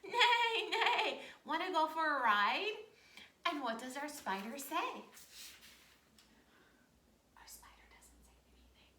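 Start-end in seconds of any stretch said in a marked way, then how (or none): 0.0-2.7 high, loud horse neigh into the question. Acted. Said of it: "The horse comes up and he says". 7.3-8.9 soft whisper, no voice at all. Shown as a demonstration. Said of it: "He's totally quiet".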